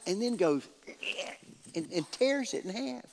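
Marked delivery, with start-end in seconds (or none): none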